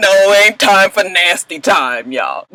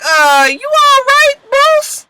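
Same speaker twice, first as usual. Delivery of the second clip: much higher, slow, doubtful question; puzzled concern; much slower than usual. Retold or imitated. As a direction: ask it high and drawn-out, unsure of him. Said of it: "Fantasia Loaf is looking at him like".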